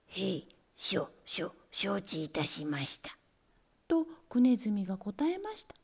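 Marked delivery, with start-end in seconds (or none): 0.1-3.2 low, stammering whispered reply; a scared rat forcing out an answer; mild frightened stammer; trembling mouse. A narrator's reading. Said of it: "足がブルブルしました".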